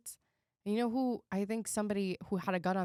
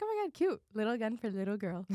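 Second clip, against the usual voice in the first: cooing cute voice. Retold or imitated. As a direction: coo at it like it's adorable. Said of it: "And I was just like".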